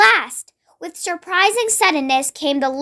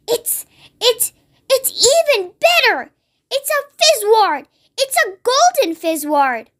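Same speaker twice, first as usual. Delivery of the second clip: thrilled giant; 8 semitones higher than usual. A narrator's reading. Giant thrilled by rare dream.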